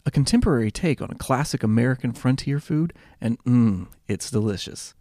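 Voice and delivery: relaxed sponsor read. Acted. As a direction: read the sponsor spot casually. Sponsor read before episode.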